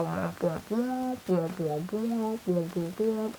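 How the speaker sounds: soft, repeated womp noises; foggy muffled voices. Retold or imitated. Waking up foggy in hospital, soft muffled womping. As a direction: imitate blurred talk heard through fog. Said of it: "It's a little foggy".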